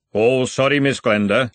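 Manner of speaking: sheepish dwarf apologising; embarrassed dwarf guard. A narrator's reading. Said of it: "colouring with embarrassment".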